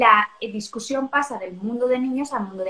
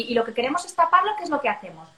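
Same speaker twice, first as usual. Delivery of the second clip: ordinary delivery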